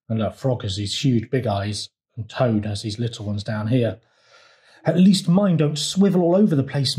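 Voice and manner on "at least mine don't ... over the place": ordinary delivery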